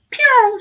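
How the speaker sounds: sharp high pew, a quick exit noise; a high, loud little pew effect; zoom sound. Retold or imitated. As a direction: zip out of the room with a pew sound. Flossie leaving fast after church.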